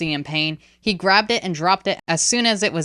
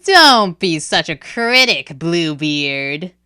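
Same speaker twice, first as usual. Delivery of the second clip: slow, teasing, louder cat voice; smiling spirit cat; 7 dB louder, slower than usual. Acted. Kaokat teasing Jabali, smiling broadly. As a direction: tease him with a broad smile. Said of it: "still smiling broadly".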